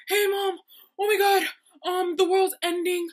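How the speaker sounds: high, loud panicked phone call; imitated hysterical farewell; frantic roommate. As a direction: shout a desperate goodbye down the phone.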